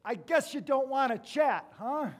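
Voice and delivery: yelled. Retold or imitated. Pastor yelling to a patient over the loud TV, sarcastic shout. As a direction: yell it into a deaf ear over the TV. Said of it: "I yelled in his ear".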